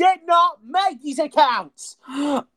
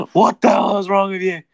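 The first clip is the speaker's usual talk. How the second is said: ordinary talk, nothing marked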